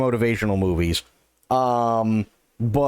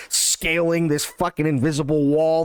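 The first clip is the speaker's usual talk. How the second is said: ordinary talk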